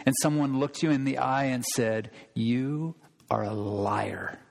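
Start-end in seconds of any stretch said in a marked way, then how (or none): 2.3-4.4 much lower, slow and soft; quiet, slow cold accusation. Shown as a demonstration. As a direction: calmly call them a liar. Someone accusing you.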